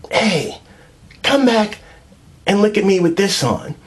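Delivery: high, sing-song calling out; mock girlfriend call. Girlfriend's voice you can't stand.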